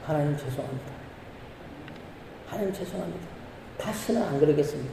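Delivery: soft, subdued apology to God; quietly re-enacted repentance; contrite prayer. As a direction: drop to a hushed, penitent murmur. Acting out his repentant prayer.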